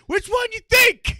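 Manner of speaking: very loud, high-pitched retort; incredulous yell back. Retold or imitated. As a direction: shout it back as if it's obvious. Teller yells back at cop.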